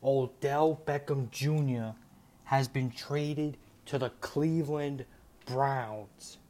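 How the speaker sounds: slow, weighty announcement, each name drawn out; slow breaking news. Real, not acted.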